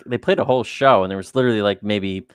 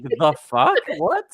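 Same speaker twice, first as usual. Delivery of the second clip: puzzled question in normal volume; baffled echo; 5 semitones higher, much slower than usual. Retold or imitated. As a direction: echo it back, rising in disbelief. Baffled listener echoes the screamed lyric.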